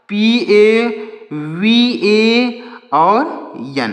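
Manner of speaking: slow dictation. Shown as a demonstration. Teacher dictating English spelling.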